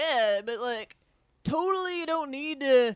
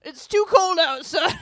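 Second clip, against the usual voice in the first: louder, higher panicked protest; nervous shout; 5 semitones higher, 6 dB louder than usual. Acted. Man backing away from the flirting women, loud. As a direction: blurt it out to escape.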